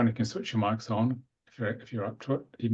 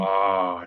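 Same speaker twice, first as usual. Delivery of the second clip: a long, louder, open ah held steady; toned ah; 2 semitones lower, 5 dB louder than usual. Acted. Breathwork leader toning a long ah with the group. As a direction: sound the ah from the base up to the crown. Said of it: "the same sound".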